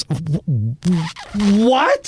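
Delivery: high-pitched incredulous "what"; stunned disbelief. Retold or imitated. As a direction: blurt it out, voice jumping way up. Retold stunned reaction to signing.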